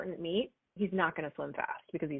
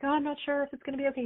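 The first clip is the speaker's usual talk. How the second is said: mock worried self; 8 semitones higher, much faster than usual. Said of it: "anytime that I'm like".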